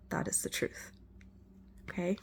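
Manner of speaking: hushed, breathy truth; whisper. Real, not acted. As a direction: drop to a secret whisper. Intimate tarot reading aside.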